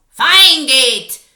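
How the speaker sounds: very loud, strange witch scream; witch's scream. Acted. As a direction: scream the order at all the witches. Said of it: "screamed the Grand High Witch".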